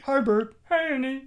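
lightly voiced Muppet pair; Bert and Ernie voices. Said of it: "One, I went an octave lower".

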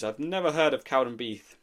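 ordinary delivery

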